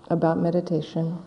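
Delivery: usual speaking voice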